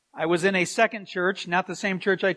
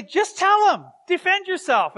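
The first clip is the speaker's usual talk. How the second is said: wife's voice; 10 semitones higher, 4 dB louder than usual. Retold or imitated. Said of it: "She would often say".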